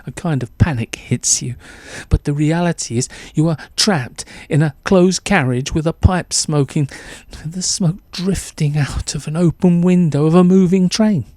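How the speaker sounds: plain reading voice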